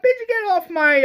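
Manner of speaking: high-pitched indignant heckle; mock retort. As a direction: shoo the character away in a high voice. Acted retort at the character onscreen.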